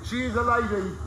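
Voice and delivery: ordinary talk, nothing marked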